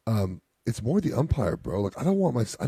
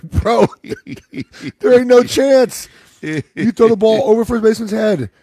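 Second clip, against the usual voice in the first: much higher, louder, laughing through the words; laughing disbelief; 12 semitones higher, 10 dB louder, slower than usual. Retold or imitated. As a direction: scoff at the idea while cracking up. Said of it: "I'm like".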